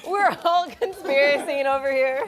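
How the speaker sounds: laughing through the words; giggling while talking loud. Real, not acted. Joking with the group about conspiracy theories, laughing.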